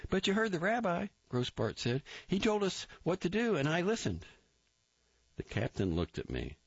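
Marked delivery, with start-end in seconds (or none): 0.1-4.2 high, eager recruit reporting; Grossbart voice. A narrator's reading. Recruit reporting to the captain, high eager voice. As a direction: snap it out high and keen.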